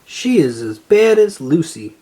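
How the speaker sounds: ordinary delivery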